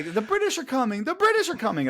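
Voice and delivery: high-pitched alarm cry; town crier's warning. Acted. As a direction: sound the alarm in a high, carrying voice. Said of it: "'cause they always say".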